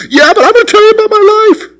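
rushed, very high mock frantic voice; worked up. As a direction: insist in a hammed-up, worked-up squeal. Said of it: "That's called getting worked up".

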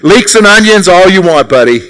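sarcastic fake welcome back; sarcastic Egyptians. Preacher voicing Egyptians greeting returning slaves, sarcastic. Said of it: "You know what I'm saying".

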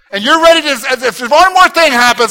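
building to a loud fed-up shout; exasperated cry of giving up; at the breaking point. Pretend. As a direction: voice someone ready to quit. Voices a listener at breaking point.

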